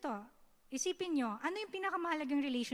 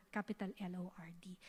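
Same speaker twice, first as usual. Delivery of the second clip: reverent whisper; 4 semitones lower, 10 dB quieter, much faster than usual. Shown as a demonstration. Reverent hushed naming of God.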